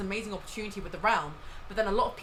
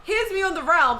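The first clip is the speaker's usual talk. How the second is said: mock streamer voice; 9 semitones higher, 8 dB louder than usual. Mocking a streamer's plug.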